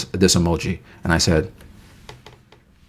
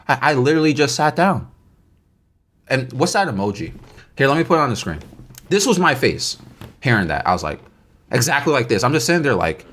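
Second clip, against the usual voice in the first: ordinary talk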